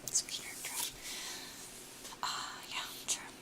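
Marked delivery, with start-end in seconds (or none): none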